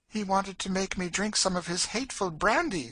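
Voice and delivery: put-on high, girlish complaint; distressed Emmeline. Girl tells of forced brandy, distressed and high. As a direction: protest in a high, upset voice.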